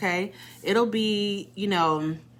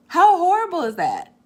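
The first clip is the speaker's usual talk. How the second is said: higher, louder rhetorical question; much higher, louder "How horrible is that?"; emphatic dismay; 11 semitones higher, 7 dB louder than usual. Real, not acted. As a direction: throw it out with disbelief. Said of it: "rhetorical emphasis".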